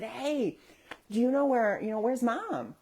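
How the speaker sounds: high, gentle coaxing question; talking to small child. Retold or imitated. Adult asks little child about Mom, child-directed voice. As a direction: pitch it up to coax a toddler. Asking child where mother is.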